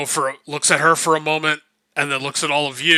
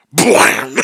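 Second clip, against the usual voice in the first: a loud burst of a crash noise; mock crash; 4 semitones higher, 5 dB louder than usual. The GM voicing eavesdroppers tumbling in, crash noise. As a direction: spit out the crash sound. People fall through door.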